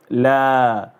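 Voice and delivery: la with a long held a; drawn-out laa; long alif. Shown as a demonstration. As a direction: sustain the vowel in la. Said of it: "this is alif".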